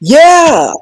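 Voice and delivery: high excited yell of yeah. Real, not acted. Host confirming the fries joke, high excited yeah.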